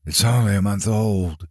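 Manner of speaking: a touch of huskiness; lightly husky, holding back. A narrator's reading. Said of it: "the young woman said huskily".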